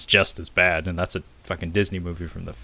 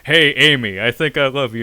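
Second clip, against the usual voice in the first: breezy, louder tossed-off love line; mock casual confession; 5 semitones higher, 7 dB louder than usual. Imagining Jack's offhand confession, mock breezy. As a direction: say it like it's no big deal. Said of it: "it would be great if he was like".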